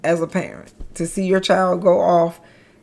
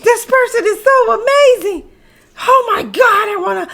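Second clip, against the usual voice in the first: high, loud overjoyed squeal; squealing with joy; 5 dB louder than usual. Retold or imitated. Mother recalling holding her newborn, squealing with joy. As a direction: squeal over the newborn like you could burst. Gushing over newborn baby.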